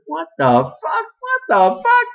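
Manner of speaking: rising shocked squeal; startled high-pitched outburst. Acted. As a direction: cry it out in high disbelief. Women bursting in, shocked.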